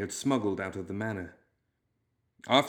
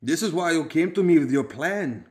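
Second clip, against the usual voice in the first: put-on loud growl, hard Balkan accent; 7 semitones higher, 6 dB louder than usual. A narrator's reading. Gang leader confronts the clerk, growling in a Balkan accent. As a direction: growl it with Balkan edges, pitched up. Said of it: "growled Fish".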